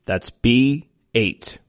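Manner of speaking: slow, drawn-out number calling; bingo caller. Acted. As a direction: call each number slow and clear.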